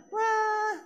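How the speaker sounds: high mock baby wail; hammed-up infant cry; imitated baby wail. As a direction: wail like a baby denied its bottle. Father retells baby crying.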